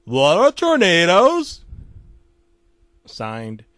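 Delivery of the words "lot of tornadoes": ominous note. Acted.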